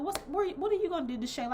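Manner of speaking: high, teasing question voice; high mocking classmates. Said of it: "they was like".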